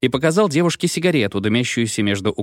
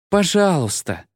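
a high, drawn-out plea; the girl pleading with Dima; 8 semitones higher, slower than usual. A narrator's reading. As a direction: beg him, stretching the word. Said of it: "умоляюще протянула девушка".

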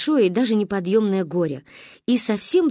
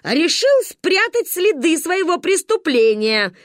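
гневно; 8 semitones higher, 4 dB louder, slower than usual. Acted. Ба продолжает гневно.

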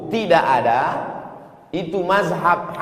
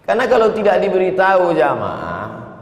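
ordinary delivery

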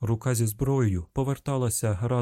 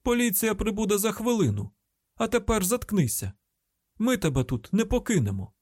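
high, forceful announcement; urgent high-pitched command; louder calling out; 10 semitones higher than usual. A narrator's reading. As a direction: call it out so it carries to the pit. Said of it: "А тоді гучніше".

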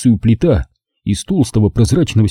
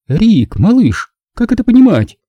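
tense Nina; 12 semitones higher, 3 dB louder than usual. A narrator's reading. Nina confronts Rick, tense and high-pitched. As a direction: press the question through tight restraint. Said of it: "напряжённо спросила она".

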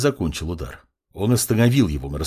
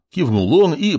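plain reading voice

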